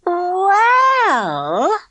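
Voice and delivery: a long high drawn-out well. A narrator's reading. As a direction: spin the word out to tease them. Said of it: "Elaine spun it out as long as she dared".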